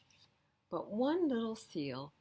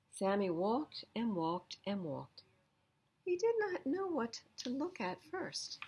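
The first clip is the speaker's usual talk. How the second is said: usual speaking voice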